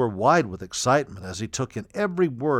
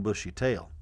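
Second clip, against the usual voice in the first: plain reading voice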